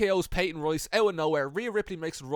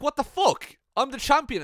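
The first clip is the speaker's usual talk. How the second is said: higher, louder mock outrage; indignant outburst; 8 semitones higher, 6 dB louder than usual. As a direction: protest loudly as the wronged champion, hammed-up. Acting outraged Rhea in retold story.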